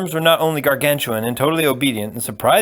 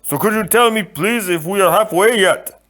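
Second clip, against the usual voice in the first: higher, louder gentle giant; a big polite man, puzzled; 9 semitones higher, 4 dB louder than usual. Acted. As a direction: giant complaining to the Sicilian on his neck. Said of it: "he went on".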